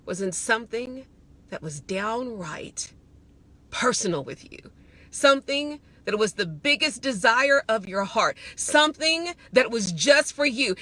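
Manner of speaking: voice of God. Retold or imitated. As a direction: speak as God, low and hushed, to a beloved daughter. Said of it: "He says".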